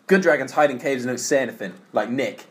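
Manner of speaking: usual speaking voice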